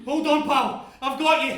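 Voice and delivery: loud call to a drowning man; urgent rescuing shout. Acted. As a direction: yell it out over the sea. Rescuer calling in water.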